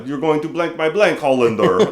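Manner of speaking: imitating a Russian hockey player's accent. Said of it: "the Russian says crazy things in his accent".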